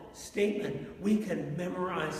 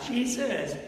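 ordinary delivery

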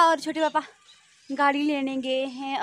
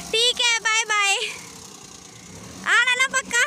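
high, louder goodbye shout; calling out farewell; 10 semitones higher, 4 dB louder than usual. Real, not acted. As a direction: call after the car so they hear.

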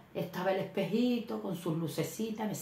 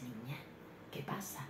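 whisper; 4 semitones lower, 14 dB quieter than usual. Retold or imitated. Sister whispering in the shop.

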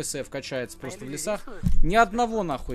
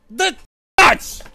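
loud angry curse; raging gamer; 13 semitones higher, 12 dB louder than usual. Real, not acted. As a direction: shout the curse in rage.

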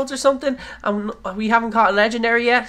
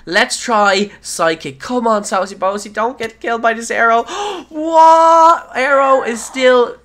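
ordinary talk

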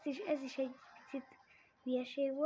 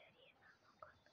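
whisper; 36 dB quieter than usual. Real, not acted. Whispered repeat.

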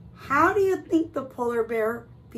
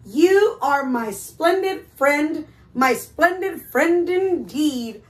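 higher, loud, measured proclamation; storybook declaration; 3 semitones higher, 6 dB louder than usual. Acted. Reader voicing the friends' happy ending, proud declaiming. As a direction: declaim it proudly, stretching the words. Reads the friends' closing lines.

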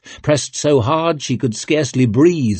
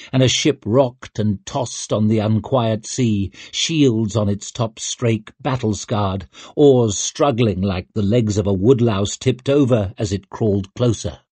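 ordinary delivery, nothing marked

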